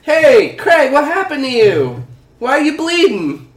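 high, loud alarmed questioning. Acted. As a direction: call out in alarm to a hurt friend. Alan finds his friend bleeding.

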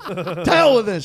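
loud, slow mock outburst; fed-up mom. Mom storming off from ruined party, fed up. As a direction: draw it out, throwing in the towel. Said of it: "She was like".

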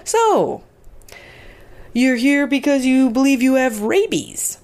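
high, loud, breezy doctor voice; upbeat doctor. Retold or imitated. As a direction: play the chipper doctor, light and bright. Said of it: "all upbeat, and he says".

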